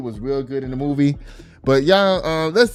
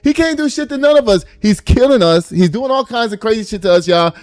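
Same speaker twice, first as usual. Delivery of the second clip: raised, fired-up preaching rant; impassioned shout; 7 semitones higher, 5 dB louder than usual. Real, not acted. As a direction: shout it like a rally speech. Political rant, rising.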